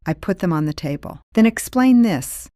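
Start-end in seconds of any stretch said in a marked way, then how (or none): 1.3-2.5 raised, louder accusing demand; loud confrontational challenge; confronting demand. Retold or imitated. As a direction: slap the proof down and challenge her. Confronting aunt with photos.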